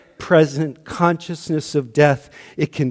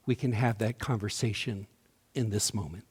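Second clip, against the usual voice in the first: softer, quieter repeat; hushed and gentle; 5 semitones lower, 11 dB quieter than usual. Shown as a demonstration. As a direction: say it softly, drawing them in. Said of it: "repeated softly, gently".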